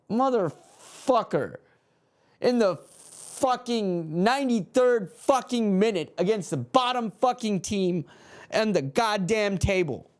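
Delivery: a fan raging at losing to the bottom team; furious rant. Real, not acted. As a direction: vent it with disgust. Ranting after the late goal.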